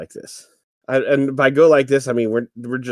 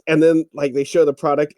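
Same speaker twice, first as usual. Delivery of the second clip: usual speaking voice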